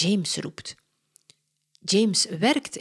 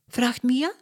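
plain reading voice

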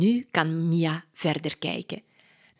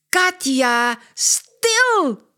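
much higher, louder hush shout; 9 semitones higher, 7 dB louder, much slower than usual. Acted. Mia shouts at chattering Katja, exasperated. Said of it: "roept Mia".

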